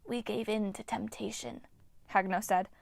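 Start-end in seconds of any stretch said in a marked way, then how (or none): 0.0-1.6 eerie breathy whisper of an ancient nymph; ghostly nymph whisper. Acted. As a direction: hiss it like a withered water spirit. Nymph Hagno speaks.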